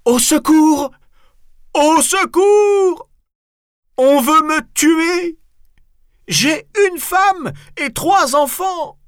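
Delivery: very high, loud, drawn-out panicked yell; hammed-up terrified shrieking; crying for help. A narrator's reading. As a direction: scream for your life, slowly wailed. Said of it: "et en criant".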